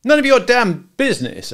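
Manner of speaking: high, loud tough-guy voice; a hint of a cocky hitman; menacing gangster. Al snaps at George.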